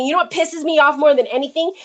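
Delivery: ordinary delivery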